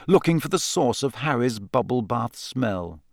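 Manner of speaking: ordinary delivery, nothing marked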